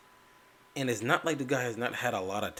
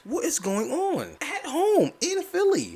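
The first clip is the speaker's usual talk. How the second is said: much higher and louder, slow exasperated outcry; outraged disbelief; 5 dB louder, slower than usual. Real, not acted. Fan fuming over loss at home in Philly, high indignant outcry. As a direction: cry out the shame of it.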